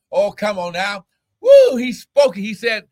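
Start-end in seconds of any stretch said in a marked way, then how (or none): 1.4-2.3 high loud woo then the words; ecstatic woo. Real, not acted. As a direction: burst out with joy. Ecstatic cry mid-sermon.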